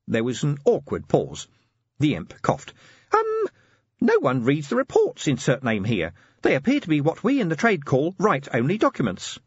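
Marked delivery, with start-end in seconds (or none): 3.1-9.4 high, chirpy little assistant; squeaky helpful gadget voice; tiny imp voice. Acted. Imp explains.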